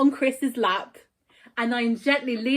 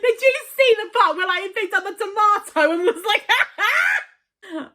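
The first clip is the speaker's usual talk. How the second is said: excited; 8 semitones higher, 5 dB louder than usual. Real, not acted. Excitedly recalling a funny scene.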